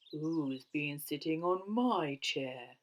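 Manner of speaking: slow, gruff booming bear; gruff Father Bear. A narrator's reading. Big Father Bear asking about his chair, gruff boom. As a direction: boom it slowly, great and gruff. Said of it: "in his great gruff voice".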